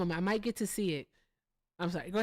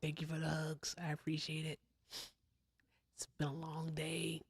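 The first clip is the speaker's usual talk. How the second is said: whisper; 8 dB quieter than usual. Real, not acted. Tired streamer winding down.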